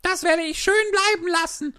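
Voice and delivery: high squeaky mouse, fast and indignant; a mouse storming off; huffy little mouse. A narrator's reading. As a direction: mouse refusing crossly as she leaves. Mouse refuses, leaving.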